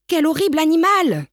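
high, loud cry of disgust; horrified crowd cry. Acted. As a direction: shout it out in shock. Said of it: "crièrent alors les gens". (la foule crie, horrifiée, plus fort et plus aigu)

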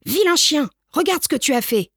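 high, fast, cross scolding; scolding diners. Acted. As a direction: tell off a naughty dog, quick and sharp. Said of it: "se mirent à grogner et à aboyer". (des clients grondent le chien, voix aiguë et rapide)

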